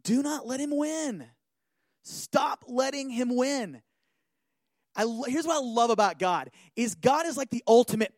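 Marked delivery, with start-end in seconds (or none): none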